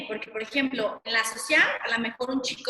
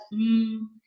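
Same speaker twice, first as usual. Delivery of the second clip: hummed m. Shown as a demonstration. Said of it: "Con los labios".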